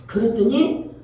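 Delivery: normal talking voice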